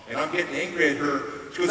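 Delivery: usual speaking voice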